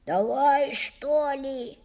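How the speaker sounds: put-on a boy's gruff giving-in; rough, grudging. A narrator's reading. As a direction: agree roughly and reluctantly. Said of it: "грубо сказал Алёшка".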